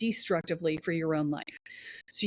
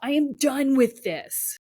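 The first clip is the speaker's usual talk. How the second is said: higher, louder, slow and final; fed-up declaration; 6 semitones higher, 5 dB louder, slower than usual. Pretend. Voicing the fed-up self at full force, slow and loud. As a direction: slam the door on it. Climax of voiced rage.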